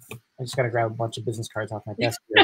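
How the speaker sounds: mumbled, offhand mock of a careless networker; lightly voiced guy grabbing cards off his desk; clueless card-dumper guy. Mocking the networker who just grabs cards off his desk. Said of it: "don't be that guy that goes with".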